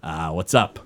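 a laid-back guy greeting a friend; casual Maxwell. Acted. Maxwell greeting Thelonious, low casual voice. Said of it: "He says".

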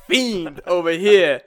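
a hint of a brash New York accent; lightly voiced New York tough guy. Host slipping into a New York accent, brash. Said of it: "now I got a New York accent for some reason".

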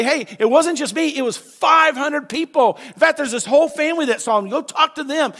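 a mock witness urging people to go check; excited eyewitness. Witness voiced by preacher.

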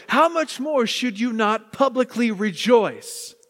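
excited, forceful preaching; slow, loud build to an exhortation. Real, not acted. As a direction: get carried away urging the congregation. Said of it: "I got so excited".

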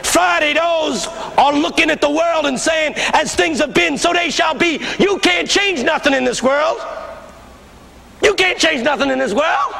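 high, insistent pulpit cry; preaching picking up. Retold or imitated. Old pastor preaching Friday's despair, fervent cry. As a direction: imitate a preacher in full flight. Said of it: "beginning to pick up now".

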